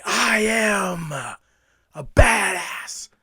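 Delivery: belts I am, then whispers badass; shouted then whispered. Shown as a demonstration. Demoing his mirror routine, shout then whisper. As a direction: shout it, then drop to a breathy hiss. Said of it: "and scream it".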